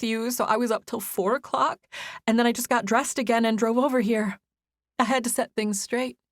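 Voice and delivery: holding back tears as she confesses; young woman voice. Acted. Izzy near tears, confessing.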